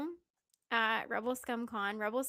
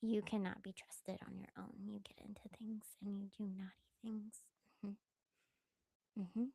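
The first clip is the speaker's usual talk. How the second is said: soft whispered pet talk; dog voice; 8 dB quieter than usual. Real, not acted. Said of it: "the dog voice is gonna come out".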